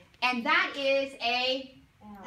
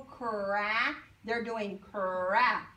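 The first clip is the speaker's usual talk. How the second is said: very slowly "cra", showing the mouth; slow demo; 4 semitones lower, much slower than usual. Shown as a demonstration. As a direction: stretch "cra" out so the kids can copy. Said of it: "watching my letter formation".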